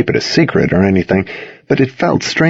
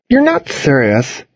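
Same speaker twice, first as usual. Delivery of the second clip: put-on slow disbelieving girl; 6 semitones higher, slower than usual. A narrator's reading.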